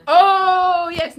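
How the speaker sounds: gasp, then a loud, high "oh"; excited gasp. Real, not acted. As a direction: burst out loudly at a welcome suggestion. Reacting to an audience suggestion.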